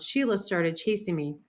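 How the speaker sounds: ordinary delivery, nothing marked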